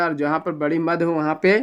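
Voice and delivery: ordinary delivery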